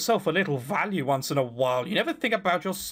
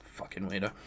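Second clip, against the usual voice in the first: muttered aside; 10 semitones lower, 11 dB quieter than usual. Real, not acted.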